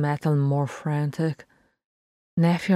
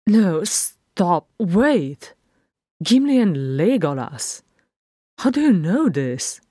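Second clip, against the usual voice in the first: higher, louder, swooping protest; alarmed disbelief; 3 semitones higher, 4 dB louder, slower than usual. A narrator's reading. Philly to Farron on the Gimli and Legolas news, alarmed disbelief. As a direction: halt them in loud, high-pitched disbelief.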